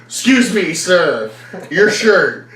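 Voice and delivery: high, loud star-struck question; gushing at a stranger over his shirt; excited fan. Retold or imitated. Fan gushing to a stranger, high and loud.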